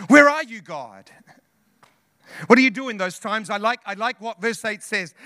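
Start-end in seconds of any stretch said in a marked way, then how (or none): 0.1-1.1 a louder cry to God with a laugh, then dropping away; louder anguished plea. Acted.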